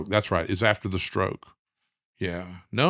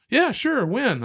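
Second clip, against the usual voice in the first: imitating the wife's high, bright instant agreement; eager yes; 14 semitones higher, slower than usual. Wife agreeing at once to go to Texas, high and eager. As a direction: jump at the idea.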